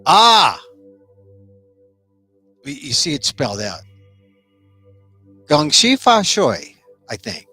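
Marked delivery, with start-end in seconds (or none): none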